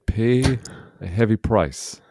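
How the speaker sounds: ordinary talk, nothing marked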